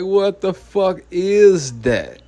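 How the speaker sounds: slow, drawn-out baffled question; baffled disbelief. Retold or imitated. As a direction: let the disbelief stretch out. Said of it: "I just look like".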